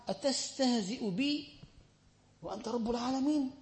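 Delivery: rapid chanted Arabic recitation; quick Arabic recitation. Acted. Quoting the hadith to the congregation, quick Arabic recitation. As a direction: rattle off the Arabic hadith text.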